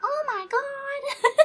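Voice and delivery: high, slow, laughing mock wail; laughing grandma "oh my god"; imitating grandma's cry. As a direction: call it out like grandma yelling at her team. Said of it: "you could just hear her from, like, across the apartment".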